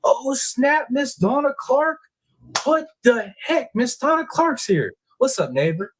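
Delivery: very high, slow squeal of surprise; thrilled high-pitched welcome; surprised delight. Real, not acted. Neighbor shows up in chat.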